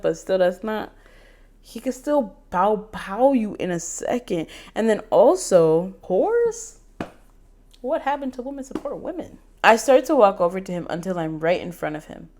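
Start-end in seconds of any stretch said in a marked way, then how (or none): 6.0-9.4 high, incredulous squeak; high shocked question. Real, not acted. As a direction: disbelief at the word. Shocked reaction to own text.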